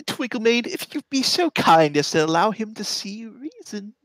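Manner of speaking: high-pitched in-character request; Member. Acted. Voices Member addressing his unicorn.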